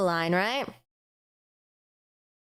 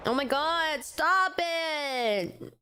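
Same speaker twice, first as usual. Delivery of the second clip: high, drawn-out whiny protest; wailing exasperation; exasperated plea; 8 semitones higher, much slower than usual. Real, not acted. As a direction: beg for it to stop, stretching the words. Begs the game to stop.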